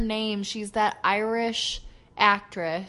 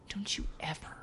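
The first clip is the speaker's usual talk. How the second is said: softer, playful menacing whisper; mock threatening whisper; 4 semitones lower, 15 dB quieter than usual. Warning cohost never to doubt Nessie, whispered. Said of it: "threatening cohost over Nessie".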